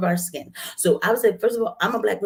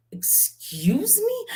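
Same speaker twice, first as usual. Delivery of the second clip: high, breathless whispered "excuse me?"; indignant whisper; 4 dB quieter, much slower than usual. Retold or imitated. Retelling being called a token, shocked whisper. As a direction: hiss it out, stunned and offended.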